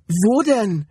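high, alarmed question; anxious high-pitched question; panicked. A narrator's reading. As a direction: demand an answer in panic. Panicked woman searching for land.

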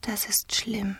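high, very soft whisper; delicate, breathy hush; tender as a bird. A narrator's reading. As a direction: say it in a tiny, fragile voice. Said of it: "hörte er die vogelzarte Stimme".

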